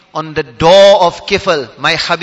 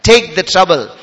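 ordinary talk, nothing marked

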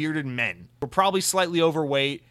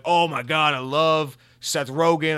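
lightly voiced gushing fan, louder; gushing fan dudes; 2 semitones higher, 5 dB louder, slower than usual. Pot-smoking dudes raving about a comedy actor, lightly mocked gushing. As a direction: imitate fans gushing, stretching out the love. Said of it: "thirty-five-year-old dudes who like to smoke pot".

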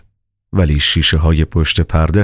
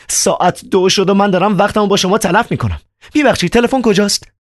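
put-on much higher, agitated young man; Ivan's voice. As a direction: play Ivan, high and worked up. Narrator voices Ivan.

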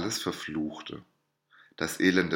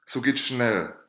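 higher, louder hurried call; urgent call; 5 semitones higher, 4 dB louder than usual. A narrator's reading. Student begging the lady to hurry, louder urgent call. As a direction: urge her to hurry. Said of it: "rief der Student".

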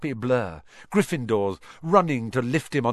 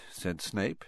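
ordinary delivery, nothing marked

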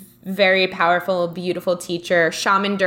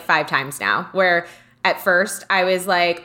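normal talking voice

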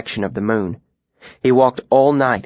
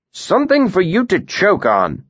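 a mild spiteful jab, higher; spat; 9 semitones higher than usual. A narrator's reading. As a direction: spit the words out, lightly. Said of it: "the tinker spat".

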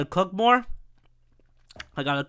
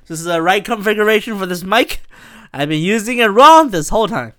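ordinary talk, nothing marked

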